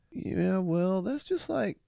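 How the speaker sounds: high lazy Dude voice; Dude impression. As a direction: shrug it off like a laid-back slacker. Quoting the Dude's reply.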